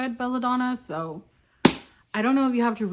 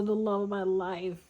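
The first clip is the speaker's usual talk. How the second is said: ordinary talk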